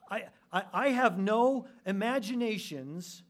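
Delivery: loud, high, forceful pulpit voice; impassioned preaching. Real, not acted. Preacher warning listeners against imagining themselves humble, loud and insistent. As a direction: drive each phrase out loud and high.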